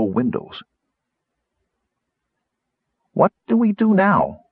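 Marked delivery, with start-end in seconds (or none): 3.1-4.4 high-pitched little gnome voice; a tiny, high voice asking for guidance; very small voice. A narrator's reading. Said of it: "in a very small voice".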